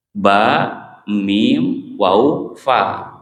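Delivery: normal talking voice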